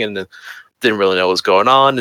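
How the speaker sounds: ordinary talk, nothing marked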